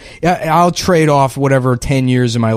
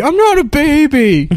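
put-on whiny baby voice; 12 semitones higher than usual.

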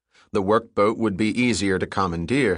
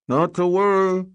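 higher, muffled call from behind drums; 10 semitones higher, 4 dB louder than usual. Acted. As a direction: call out, muffled, from inside a hiding spot. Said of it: "Zybig's muffled voice echoed in reply".

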